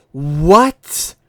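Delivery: loud, stunned "What?"; shocked. Real, not acted. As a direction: react in loud disbelief. Shocked at a twelve month ban.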